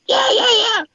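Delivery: high, loud eager yeses; excited yeah. Retold or imitated. Recognizing the Wheeler family, loud and high eager agreement. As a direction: blurt it out, keen to agree. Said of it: "I'm like".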